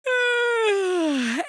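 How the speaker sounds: long high yawning sigh. Real, not acted. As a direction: yawn it out. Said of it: "Excuse the yawns".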